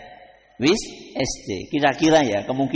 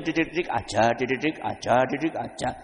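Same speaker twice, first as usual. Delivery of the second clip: nagging repetition; 4 semitones lower, 4 dB quieter than usual. Shown as a demonstration. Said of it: "yang nggak boleh itu berlebihan".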